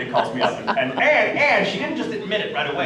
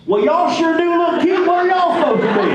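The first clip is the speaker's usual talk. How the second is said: Texas dad voice; 12 semitones higher, 5 dB louder than usual. Acted. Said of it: "I immediately go into my Texas dad voice".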